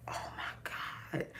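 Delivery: breathless whispered shock; stunned whisper. Real, not acted. To herself, learning she was moody, whispered shock. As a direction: hush it out in disbelief.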